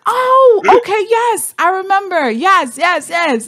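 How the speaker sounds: high, bright oh of realising, then eager yeses; sudden recognition. Retold or imitated. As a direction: jump up in pitch as it clicks.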